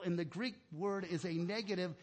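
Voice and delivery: ordinary delivery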